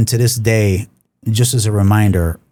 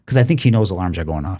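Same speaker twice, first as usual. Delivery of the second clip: ordinary talk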